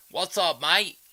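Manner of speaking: high, loud attempt at Australian; bad Australian accent. Greeting a viewer in chat, bad Australian accent. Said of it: "I suck at an Australian accent".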